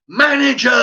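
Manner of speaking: high, loud yell; screaming for the manager. Retold or imitated. Customer yelling it over and over, screaming. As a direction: bellow it as a repeated demand. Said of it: "Like this, five, six, seven times".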